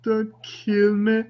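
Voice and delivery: high, drawn-out, hammed-up pleading; McDiarmid's feeble Palpatine. Podcaster mocking a terrible film performance, hammed-up feeble plea. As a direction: overact a weakling begging for life, very slow. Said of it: "McDiarmid is amazing, e-, even when he's absolutely terrible".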